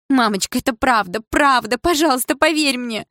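high, rising desperate entreaty; pleading. A narrator's reading. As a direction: beg mother to believe you. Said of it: "почти взмолилась я". (умоляюще, с отчаянием)

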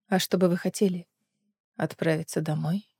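softly asking; gentle low question; quiet. A narrator's reading. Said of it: "тихо ответила Гленис".

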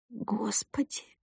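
slow breathless whisper of dread; whispered moan. Acted. Bride hearing the leg may be broken, whispered moan. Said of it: "простонала я".

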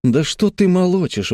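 put-on high-pitched boy's indignant retort; flaring up. A narrator's reading. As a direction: snap back at a little sister. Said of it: "взвился Тим".

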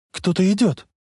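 high, lightly acted alarm; frightened. A narrator's reading. Girl sees figures approaching the car, mildly frightened. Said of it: "испуганно сказала Лоррейн".

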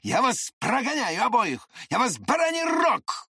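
very high, frantic yell; Lambert's screaming threat. A narrator's reading. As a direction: scream the threat, climbing to a shriek at the end. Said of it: "завопил Ламберт".

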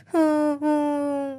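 a short high laugh; burst of laughter. A narrator's reading. Uncle bursts out laughing at the boy's idea. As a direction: snort out a laugh. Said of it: "Anh Nam nghe thấy thì phì cười".

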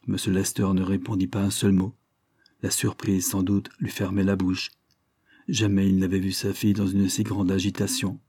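ordinary delivery, nothing marked

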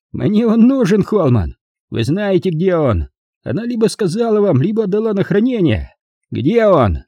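high-pitched theatrical interrogator; demanding, angry Mike. A narrator's reading.

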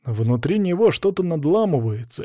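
plain reading voice

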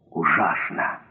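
тихий низкий стон ужаса; простони еле слышно, низким голосом. A narrator's reading. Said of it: "простонал он". (тихо, со стоном)

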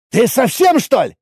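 high, indignant outburst; incensed. A narrator's reading. Warrior leaping up at the player, incensed. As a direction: jump on him with the question. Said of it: "вскочил воин".

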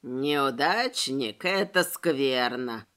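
low, slow, dismayed sigh; saddened skeleton. A narrator's reading. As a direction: drag it out in gloom. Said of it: "огорчился скелет".

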